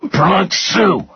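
high, pained gasp. A narrator's reading. Rudder answering under threat of another broken finger, gasping. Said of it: "Rudder gasped".

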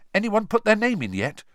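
high, loud eager question. A narrator's reading. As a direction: ask with eager excitement. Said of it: "Ron asked a third-year girl eagerly".

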